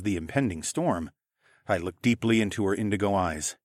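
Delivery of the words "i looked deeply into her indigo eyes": ordinary delivery, nothing marked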